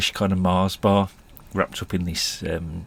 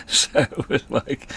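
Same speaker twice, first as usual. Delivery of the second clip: laughing; 6 semitones higher, slower than usual. Real, not acted. Laughing through the story.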